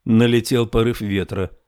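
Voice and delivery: usual speaking voice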